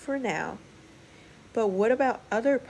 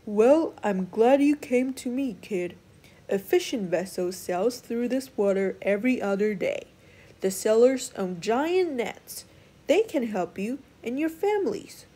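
put-on old wise clam; 5 dB louder than usual.

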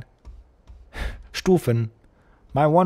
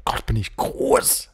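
whispered, lower in pitch; 5 semitones lower than usual. Pretend. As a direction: breathe it out as a whisper. Whispered second take.

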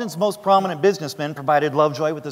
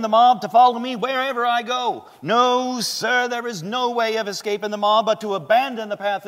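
Elijah Lovejoy's defiance; 10 semitones higher, 4 dB louder than usual. Acted. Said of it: "Lovejoy responded by addressing the body".

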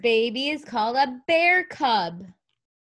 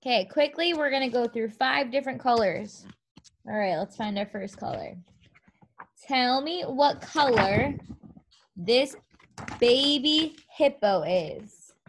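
ordinary talk, nothing marked

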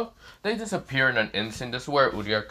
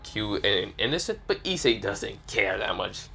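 usual speaking voice

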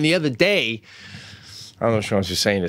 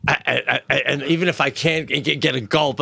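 ordinary talk

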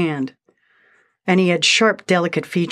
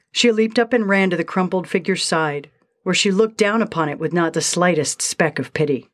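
ordinary delivery

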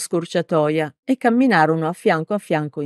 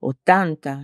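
ordinary delivery

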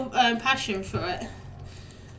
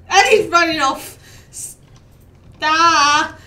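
loud real yell after a runaway goat; 4 semitones higher, 9 dB louder, slower than usual. Real, not acted. Streamer yelling at her runaway goat, loud. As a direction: shout after the escaping goat. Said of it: "I'm so sorry about my screaming".

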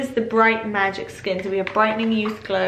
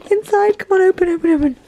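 high, loud eager coaxing; excited urging; 9 semitones higher, 6 dB louder, faster than usual. Real, not acted. Unboxing vlogger urging the package open, excited. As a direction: urge the box open, thrilled.

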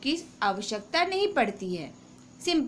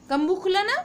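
excited check; 8 semitones higher, 5 dB louder, faster than usual. Real, not acted. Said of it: "checking with class".

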